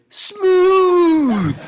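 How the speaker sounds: high, loud burst of delight; loud exclamation. Retold or imitated. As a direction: shout it out after a strong drink. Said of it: "and went".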